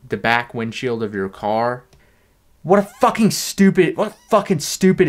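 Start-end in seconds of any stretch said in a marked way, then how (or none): 2.6-5.1 exasperated outburst. Real, not acted. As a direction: blurt out your contempt. Outburst at sticker.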